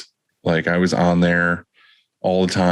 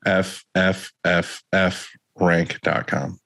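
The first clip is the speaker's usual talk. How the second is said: slow; much slower than usual. Acted. Spelling out the web address.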